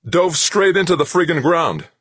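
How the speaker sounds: high, loud alarmed report; urgent high-pitched announcement; ringing call. A narrator's reading. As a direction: ring it out across the room. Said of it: "a technician's voice rang out".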